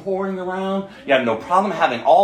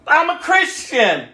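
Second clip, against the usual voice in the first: mock claim; 7 semitones higher, 3 dB louder, slower than usual. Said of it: "what people are seeing".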